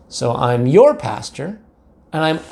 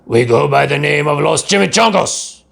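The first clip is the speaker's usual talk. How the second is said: a touch of a gruff growl; 3 dB louder than usual. A narrator's reading. Gang leader Don Diego growling at the kitten, mildly gruff. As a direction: growl it like a tough little gang boss. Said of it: "growled Don Diego".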